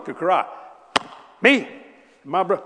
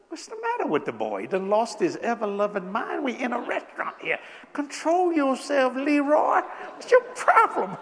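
higher, rising incredulity; incredulous aside; 8 semitones higher than usual. Retold or imitated. Man scolding his mushy friend at dinner, incredulous. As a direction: scold him in disbelief.

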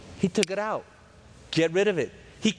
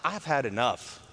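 mock weary God; 5 semitones lower, 4 dB quieter than usual. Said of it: "God's like".

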